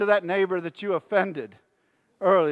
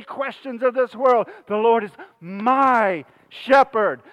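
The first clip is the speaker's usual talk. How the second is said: raised voice, driving list of clauses; fervent preaching litany; 3 semitones higher, 5 dB louder than usual. Real, not acted. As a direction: pile up each "when" with mounting fervor.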